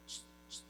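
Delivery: soft voiceless shushes; two quick quiet shushes; gentle shushing. Acted. God quiets someone before a miracle, gentle shushing. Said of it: "a veces Dios te dice".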